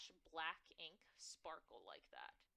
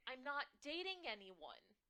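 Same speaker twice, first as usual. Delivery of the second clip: loud denial; 6 semitones higher, 7 dB louder than usual. Acted. Daughter denying loudly.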